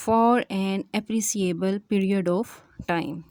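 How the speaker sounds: slow, spaced-out dictation of a definition. Acted. Teacher dictating the monophthong definition to students, slowly and deliberately. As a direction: read each phrase slowly so students can write it down.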